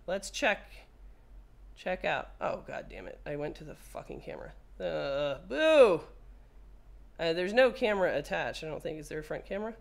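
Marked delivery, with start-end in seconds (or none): 5.5-6.0 sudden loud disappointed boo; high, loud boo. Real, not acted. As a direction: boo the failure loudly. Dismayed at camera fail.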